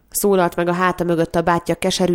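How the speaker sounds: plain reading voice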